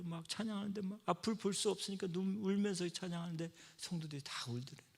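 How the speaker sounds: ordinary talk, nothing marked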